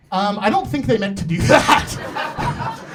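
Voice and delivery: slow, loud mock protest. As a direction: drag it out like a shocked bystander. Mock reaction quote.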